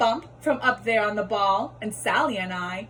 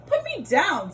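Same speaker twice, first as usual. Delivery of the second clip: fish pleads again; 6 semitones higher than usual. Acted. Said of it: "said the fish".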